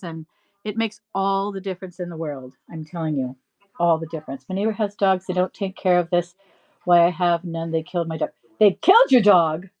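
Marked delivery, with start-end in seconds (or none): none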